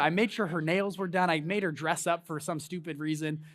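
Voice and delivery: usual speaking voice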